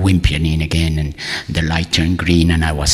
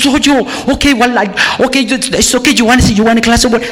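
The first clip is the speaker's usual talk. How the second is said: high, panicky babble of reassurance; flustered receptionist; much faster than usual. Retold or imitated. Receptionist offering water to a crying patient, flustered. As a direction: scramble to help, pitched way up.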